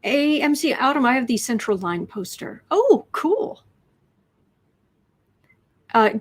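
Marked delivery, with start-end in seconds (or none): 2.7-3.6 excited. Real, not acted. Reacting to chat poster.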